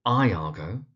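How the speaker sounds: Iago said eye-AH-go; wrong Iago, starting like "eye"; mispronunciation. Shown as a demonstration. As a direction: open Iago with an "eye", then AH-go. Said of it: "you do find mispronunciations such as".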